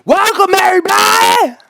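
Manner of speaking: high, loud mock greeting yell; obnoxious scream. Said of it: "scream, like, obnoxiously".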